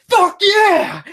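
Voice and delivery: high, loud excited yell; mock hype shout. Impersonating a wild game character to a friend, excited shout. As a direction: whoop it like a hyped-up guy. Voicing a hyped man in underwear.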